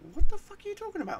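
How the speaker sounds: very high, loud mock outburst; incredulous ex-wife. Ex-wife reacting to his strange memories, high mock incredulity. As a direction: rush it out as a squeaky, baffled imitation. Acting out ex-wife's baffled reply.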